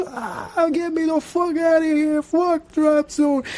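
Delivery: high panicked mock shriek, slow at the end; hammed-up terrified yelling; imitated scream from above. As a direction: yell like you're stuck at the top of a drop ride. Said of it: "I could hear him at the top".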